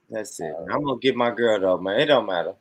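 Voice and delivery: normal talking voice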